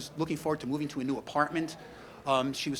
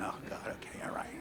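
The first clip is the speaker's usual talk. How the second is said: whispered and weary; resigned whisper; 6 semitones lower, 10 dB quieter than usual. Retold or imitated. Answering wife about the bird spirit, whispered resignation. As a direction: sigh it out under your breath. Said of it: "I went".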